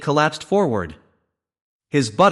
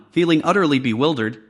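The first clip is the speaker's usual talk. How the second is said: ordinary delivery, nothing marked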